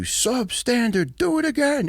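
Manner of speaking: high, drawn-out drill sergeant bark; drill sergeant yell. Retold or imitated. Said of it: "they'd scream at you".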